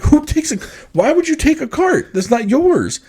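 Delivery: high-pitched, incredulous indignation; retold outrage, pitch jumping way up; steamed. Shopper fuming over his taken cart, steamed and indignant. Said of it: "I'm just steamed".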